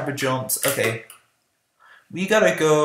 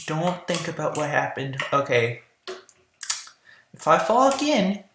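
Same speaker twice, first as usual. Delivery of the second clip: ordinary talk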